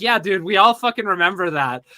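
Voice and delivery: usual speaking voice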